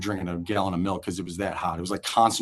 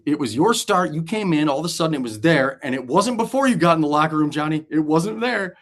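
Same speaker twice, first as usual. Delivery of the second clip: higher, louder accusing teammate; mock accusation; 6 semitones higher, 4 dB louder than usual. Casey accusing Johnny about the dump, hammed-up. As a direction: pin the blame on him.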